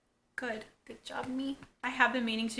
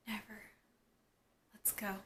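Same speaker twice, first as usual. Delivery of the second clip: quiet; 14 dB quieter than usual. Retold or imitated. Heather answers Erica at the clinic.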